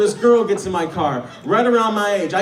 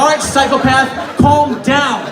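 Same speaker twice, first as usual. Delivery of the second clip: mock shout; 4 semitones higher, 4 dB louder, slower than usual. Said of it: "You'd be like".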